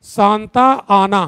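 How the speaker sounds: Santa आना said slowly and evenly; slow dictation. Shown as a demonstration. Teacher dictating Santa आना slowly to the class. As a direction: drag Santa आना out for note-taking. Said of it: "लिखो, बहुत important है".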